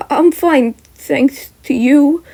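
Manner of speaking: high, shaky slow reply; a touch of fear, stammering; terrified stammer. Acted. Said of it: "Mineta stammers ... terrified".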